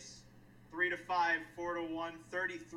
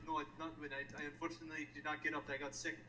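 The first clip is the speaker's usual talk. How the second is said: bad Scandinavian accent; 5 semitones lower, 8 dB quieter, much faster than usual. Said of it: "That's like the worst Scandinavian accent".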